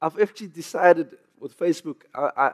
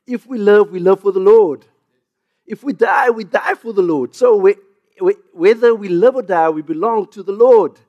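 high, loud, driving scripture reading; full-force preaching; 5 semitones higher, 7 dB louder than usual. Acted. Pastor preaching Romans to his congregation, at full force. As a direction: preach it out to the whole room.

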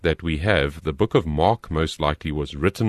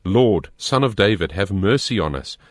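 ordinary delivery

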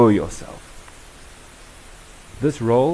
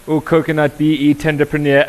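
mock name-calling; 5 semitones higher, 6 dB louder than usual. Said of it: "And we hear".

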